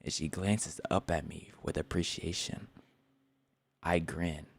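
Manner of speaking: soft, slow novel reading; book reading. Acted. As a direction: read the passage quietly. Continues reading.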